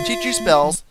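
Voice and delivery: usual speaking voice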